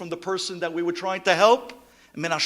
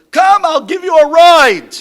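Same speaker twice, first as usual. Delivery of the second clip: loud, high, pleading invitation; insistent offer; 13 semitones higher, 19 dB louder than usual. Retold or imitated. Rabbi pressing the chacham to ride, loud insistent offer. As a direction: urge him hard, come on. Urging the elder, raised voice.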